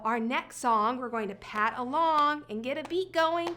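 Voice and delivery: ordinary delivery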